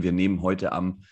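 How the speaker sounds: usual speaking voice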